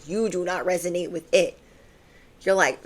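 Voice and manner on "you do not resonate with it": ordinary talk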